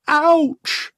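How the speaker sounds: high, sudden cartoon "ouch"; exaggerated little cry of pain; mock yelp. Professor's cry as he fires.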